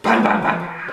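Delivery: plain reading voice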